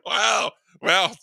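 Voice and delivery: ordinary talk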